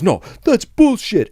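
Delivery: high, loud, drawn-out protest; mock angry customer. Angry guest in line protesting to staff, mock outrage. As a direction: imitate an outraged customer objecting.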